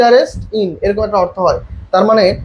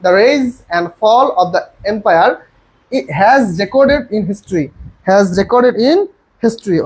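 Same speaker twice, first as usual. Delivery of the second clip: slow dictation; much slower than usual. Shown as a demonstration. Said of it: "তাহলে".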